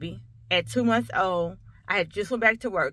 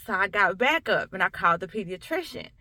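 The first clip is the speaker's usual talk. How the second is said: ordinary talk, nothing marked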